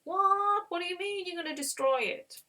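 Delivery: high-pitched incredulous outburst; shocked disbelief. Retold or imitated. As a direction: act out stunned protest at terrible news. Abraham shocked at planned destruction.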